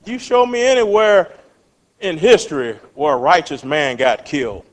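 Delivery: ordinary talk, nothing marked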